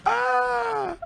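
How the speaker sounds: very high, long wheeze of laughter; high laughing squeal. Real, not acted. Commentator recovering from own joke, high squeal.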